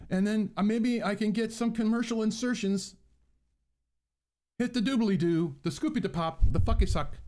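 high, bouncy, hammed-up vlogger chatter; mock YouTuber voice. Vlogger parodying YouTubers who talk like this, high and hammed-up. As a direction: overdo the peppy YouTuber talk. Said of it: "And they have to talk like this".

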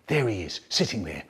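usual speaking voice

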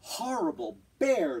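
put-on loud, furious shout of the girl; angry shout. The girl shouting at the bear for breaking her kite, angry. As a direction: yell it at the bear, cross. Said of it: "the girl shouted".

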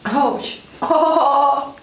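ordinary delivery

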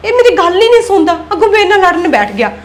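frustrated outburst. Acted. Venting alone about husband.

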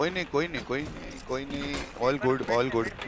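softly reassuring, fading; calm the team down gently. Real, not acted. Calming teammate after lost fight.

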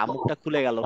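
normal talking voice

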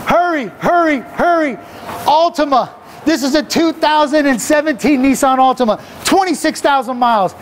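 car ad pitch at full throttle, racing the clock; hyped car-dealer pitch. Acted. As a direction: shout the deals like a manic auctioneer. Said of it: "I got a little excited out there".

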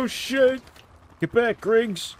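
high, loud panicked shout; startled yell. Real, not acted. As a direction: yell it in sudden alarm. Sudden danger in firefight.

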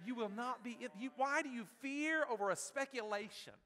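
high, loud, forceful preaching; full-force preacher call. Real, not acted. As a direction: drive it home from the pulpit. Preacher rising to a climax.

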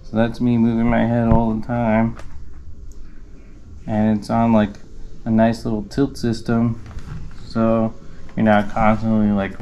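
muffled behind face covering; speaking inside closed helmet; through a full helmet. Real, not acted. Seen 2: Full enclosed helmet covers face while speaking; references show bare face.

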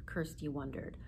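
plain reading voice

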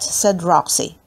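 ordinary delivery, nothing marked

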